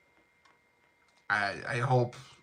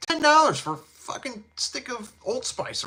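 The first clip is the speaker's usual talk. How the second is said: outraged disbelief; 11 semitones higher than usual. Real, not acted. Griping to listeners about Walgreens deodorant prices, outraged high-pitched disbelief.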